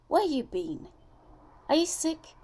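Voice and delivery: worried mother. Acted. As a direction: press him with anxious questions.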